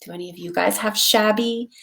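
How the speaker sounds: ordinary delivery, nothing marked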